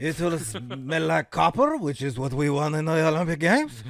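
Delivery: mock French onlooker; French accent. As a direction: muse darkly in a French accent.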